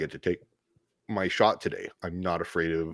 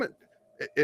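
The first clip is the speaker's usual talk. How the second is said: ordinary talk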